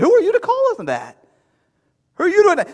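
indignant crowd. Retold or imitated. Imagined crowd scolding Jesus.

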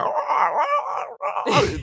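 high shrieking monster cry; monster roar. Retold or imitated. Monster in the streets.

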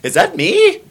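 high, loud disbelief; shocked excitement. Retold or imitated. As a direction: act stunned at hearing yourself. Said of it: "I would lose my shit if it was me".

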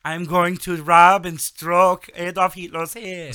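high, loud mock Russian cosmonaut voice; Russian accent. Podcast host imitating a Russian cosmonaut, in a hammed-up Russian accent. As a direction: ham up a bad Russian accent. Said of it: "I can't do Russian accent".

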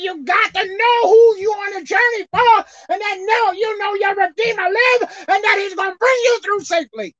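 high, loud preaching that cries out; fiery preaching. Real, not acted. Preacher to his congregation about life's journey, fiery crying preaching. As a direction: shout it to the church with a cry in the voice.

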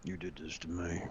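soft, hushed mock accusing; a hint of a wronged victim, quiet; hushed fake blame; mock accusation. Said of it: "I was gonna end it with".

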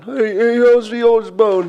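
high, drawn-out mock Brummie. Host joking as the rock singer, in mock Brummie. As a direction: slur it out in a hammed-up Brummie. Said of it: "there's me, [name]".

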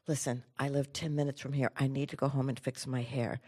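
low, hushed private plea; very quiet. Retold or imitated. Girl appealing privately to her teacher, very quietly. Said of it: "I say very quietly".

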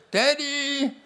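high little voice calling Daddy; little child's voice. Retold or imitated. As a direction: call out like a small boy stuck in a tree. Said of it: "I heard this little voice say".

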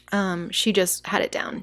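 ordinary talk

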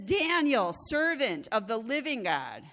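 slow anguished call to Daniel. Acted. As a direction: call into the den, fearing the worst. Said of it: "in an anguished voice".

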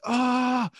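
high-pitched imitated scream, held back in volume; mock scream. Storyteller imitating the man in the wheelchair, mock scream. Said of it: "he screamed".

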